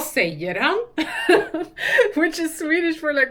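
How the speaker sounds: loud, laughing through the words. Real, not acted. Asked Dana in Swedish by habit, laughing. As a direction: crack up at your own slip. Laughs at own language slip.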